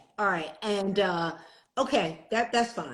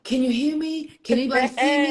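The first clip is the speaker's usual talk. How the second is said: raised, higher-pitched check for a connection; playful anxious hailing of an unseen audience; calling out; 5 semitones higher, 6 dB louder, faster than usual. Acted. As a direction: call out to see who's there. Acted calling out on stream.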